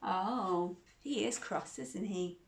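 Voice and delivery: ordinary delivery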